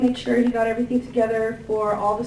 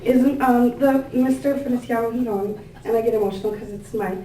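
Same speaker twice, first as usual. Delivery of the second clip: ordinary talk, nothing marked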